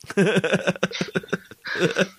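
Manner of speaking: a burst of high laughter; laughing. Real, not acted. Laughs at the name joke.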